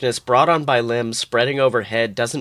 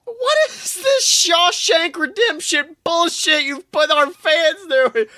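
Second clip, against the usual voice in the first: mock crying complaint; 14 semitones higher than usual.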